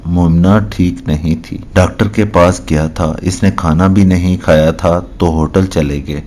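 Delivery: ordinary delivery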